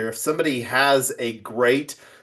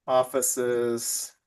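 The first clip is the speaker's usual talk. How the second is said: slow, halting murmur while typing; word by word, trailing softer with long gaps; dictating to self; 5 dB quieter, slower than usual. Real, not acted. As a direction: read it out softly as you write it down. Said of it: "let me actually put family offices down here".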